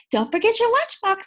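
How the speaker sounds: high, loud sing-song reminder; cheery mom. Acted. Mom reminds Splat about his lunchbox, high and sing-song. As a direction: call it after him brightly. Mom's line read aloud.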